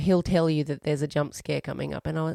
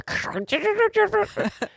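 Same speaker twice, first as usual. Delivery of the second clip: high stuttering crackle of a broken microphone; garbled headset distortion. Retold or imitated. Co-host mocking the fairground guy's headset, garbled imitation. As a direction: imitate a badly distorting headset mic in gibberish. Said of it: "microphone was really badly distorting".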